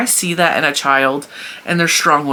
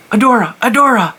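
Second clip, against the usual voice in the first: calling out; 7 semitones higher, 6 dB louder than usual. Retold or imitated. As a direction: call a child's name, higher and louder. Calling niece to look.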